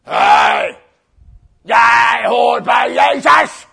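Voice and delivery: slow, loud bellowing soldier; drawn-out mock soldier roar; big soldier. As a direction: bellow the accusation like a hulking guard. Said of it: "komt er een grote soldaat".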